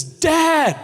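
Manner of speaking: put-on a high, loud child's call of Dad; yelling across room. Son calling his dad across the cafeteria, high and loud. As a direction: call out over a noisy lunchroom. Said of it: "he yells at me".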